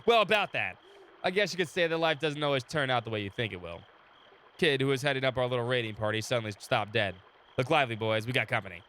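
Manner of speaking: steady storyteller read of the game text; story narration. Acted. Streamer reading the game story to chat, calm narration. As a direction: narrate the scene for the viewers.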